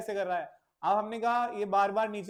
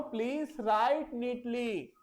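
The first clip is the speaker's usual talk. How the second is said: teacher instruction; 3 semitones higher, slower than usual. Acted. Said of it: "मैं इस बच्चे को बोल रहा हूं".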